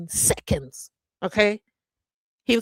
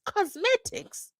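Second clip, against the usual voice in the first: COSMETICS drawn out very high, rising into a question; incredulous echo; 14 semitones higher, slower than usual. Pretend. Host balks at the interpreter's word choice, incredulous. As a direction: squeal "Cosmetics" slowly as a disbelieving question.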